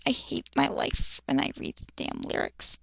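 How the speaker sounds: whispered, under the breath; whispered complaint. Real, not acted. Reader grumbling to himself about the lyrics, whispered under his breath. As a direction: hiss it to yourself in despair.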